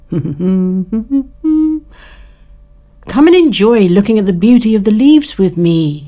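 high, loud, cheery insect voice; carefree grasshopper. Acted. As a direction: call out like a happy-go-lucky grasshopper.

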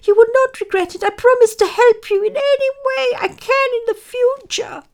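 loud, very high, urgent squeak; begging mouse. A narrator's reading. Mouse bargains with the lion for its life, squeaky begging. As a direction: promise desperately in a piping mouse voice. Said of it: "the mouse begged".

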